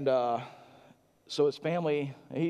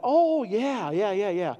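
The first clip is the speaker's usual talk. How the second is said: high, bright-sounding eager agreement; old man nodding along; a light sketch of an agreeable patient; imitating an eager patient; 9 semitones higher, 5 dB louder, slower than usual.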